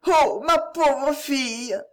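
mother grieving over her daughter, slow and despairing; despairing cry. A narrator's reading. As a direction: ache over each word, drawn out and pleading. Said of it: "avec un accent désespéré".

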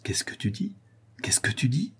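hushed, disbelieving question, rising at the end; dumbfounded, voice lifting on the repeat; stupefied. A narrator's reading. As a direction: ask it in stunned bewilderment. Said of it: "reprit Morel stupéfait".

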